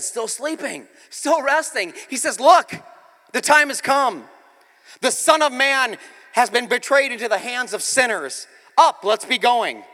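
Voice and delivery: loud, high-pitched preacher's rendering of a command; urgent rousing call. Retold or imitated. Jesus waking his disciples as betrayal arrives, urgent rousing call. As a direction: rouse them forcefully, pausing between bursts.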